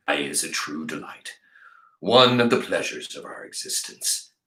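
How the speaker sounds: put-on a kindly old vagabond; deep old man. Man's voice, Bull.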